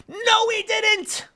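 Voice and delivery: high, slow exasperated denial; exasperated disbelief. Real, not acted. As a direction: stretch it out in disbelief. Exasperated at headline.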